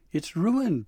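high, loud acted wail; sobbing. A narrator's reading. Dabbledy seeing presents strewn in snow, acted sobbing wail. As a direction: wail it in mock-tearful despair. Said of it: "Dabbledy sobbed".

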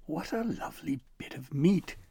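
a hint of a whispered, greedy troll; drooling troll. A narrator's reading. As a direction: breathe it out over the meat, salivating. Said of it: "Gristle salivated".

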